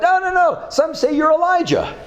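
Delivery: high, eager mock shout of a disciple. Bartholomew, answering Jesus, in a high eager mock shout. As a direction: call it out high like a keen student. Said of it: "Bartholomew shouts out".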